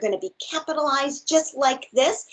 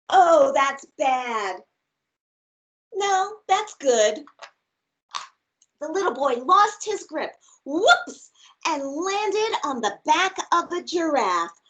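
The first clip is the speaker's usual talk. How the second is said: lively, expressive storybook read; reading to children; slower than usual. Acted. Teacher reads a picture book aloud to her class, bright and expressive. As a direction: read it big and playful for little listeners.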